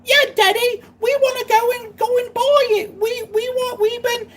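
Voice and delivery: high squeaky kid in mock Cockney; exaggerated high-pitched English kid accent; English child accent. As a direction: pipe up as a little English child. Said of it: "They have the super English accent".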